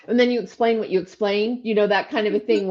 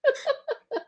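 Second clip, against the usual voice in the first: high, light chuckle; short amused laugh; 4 dB quieter than usual. Real, not acted. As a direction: laugh along briefly. Coach laughs after mentioning Diego laughing.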